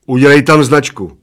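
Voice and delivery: forceful bald man's command. A narrator's reading. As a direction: order him firmly. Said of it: "poručil Plešatec".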